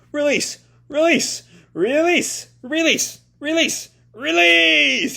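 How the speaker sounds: shouting. Acted. Tokyo shouting to learn to control his body. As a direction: hammer each word as a command. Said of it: "I've been shouting so much as Tokyo".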